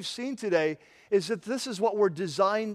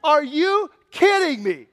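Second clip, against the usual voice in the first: loud, high, disbelieving rant; incredulous outrage; 6 semitones higher, 7 dB louder than usual. Acted. Preacher scolding discouraging older Christians, loud and outraged. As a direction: hammer each word, furious. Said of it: "He's like".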